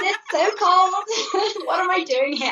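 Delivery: high, drawn-out playful wail breaking into laughter; mock complaint. Visiting author joking about freezing in Boston, high mock wail. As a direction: moan it comically, stretching the words high.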